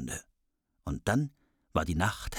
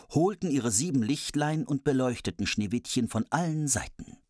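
ordinary delivery

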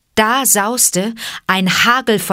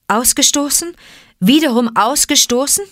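higher, rising incredulous questions; dismayed disbelief; 7 semitones higher, 4 dB louder than usual. Acted. Mowgli learning he is cast out once more, dismayed disbelief. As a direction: echo it in hurt disbelief.

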